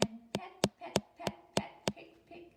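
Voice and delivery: pecks rising high, then dropping to a whisper; building then whispered. A narrator's reading. Teacher building suspense before the egg hatches. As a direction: tap it out, then hush it.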